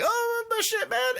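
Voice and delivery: very high mock surprise; hammed-up flirty squadmate. Podcaster imitating a soldier learning she's a sister, high mock surprise. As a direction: squeal it in comic surprise.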